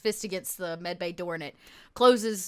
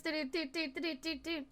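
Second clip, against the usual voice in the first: higher, softer doo-doo tune; imitated electronic jingle; 6 semitones higher, 10 dB quieter than usual. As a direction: trill out a little machine melody, quietly. Imitates the tune after the message.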